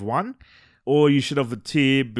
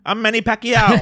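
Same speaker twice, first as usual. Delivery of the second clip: loud, higher-pitched boxer's boast, half laughing; Manny Pacquiao; 7 semitones higher, 5 dB louder than usual. Acted. Co-host imagining the boxer walking up, loud playful boast. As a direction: announce yourself like a swaggering champion, playfully. Mock quote of boxer storming in.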